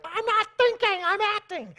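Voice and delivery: high, loud mock meltdown; hammed-up emotional retort, pitch way up; worked-up outburst. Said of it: "someone's got their emotions all worked up".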